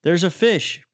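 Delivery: high calling out across the ice; urgent high call for a friend. Retold or imitated. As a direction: call him over, pitched high. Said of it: "I start calling for Chuck".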